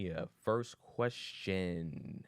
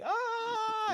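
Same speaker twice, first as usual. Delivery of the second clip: mock falling yelp. Falling person scream.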